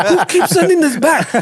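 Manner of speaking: high, laughing mock outrage; mock complaint, laughing. Roommates joking about returned jobs, laughing mock gripe. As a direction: poke fun at the grumbling boss.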